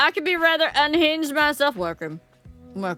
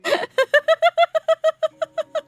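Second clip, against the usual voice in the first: squealing burst of laughter; high shrieking laugh; 8 dB louder than usual. Real, not acted. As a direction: cackle high and loud at chat.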